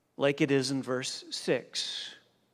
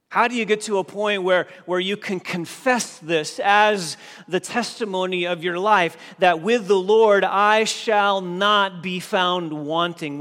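preaching at full force; 3 semitones higher, 5 dB louder than usual. Acted. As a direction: drive the promise home from the pulpit.

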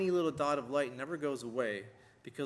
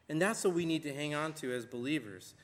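ordinary delivery

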